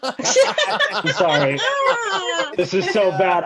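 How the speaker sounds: high, slow apology through laughter; sorry breaking into laughs; laughing apology. Acted. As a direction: giggle out a mock-sheepish sorry. Mock embarrassed apology, laughing.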